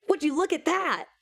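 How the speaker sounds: high, mock yell at a passing sight; a lightly voiced yell, pitched well up. A narrator's reading. Friend calls out at a wrong-way taxi, high mock yell. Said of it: "Brandon yelled".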